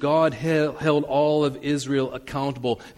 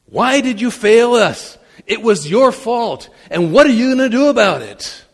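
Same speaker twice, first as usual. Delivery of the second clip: loud, high and accusing; accusing outcry; 9 semitones higher, 8 dB louder than usual. Acted. Joshua putting all the blame on God, loud and accusing. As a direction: fling the blame straight at God.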